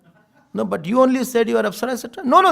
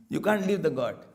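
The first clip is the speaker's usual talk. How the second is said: ordinary talk, nothing marked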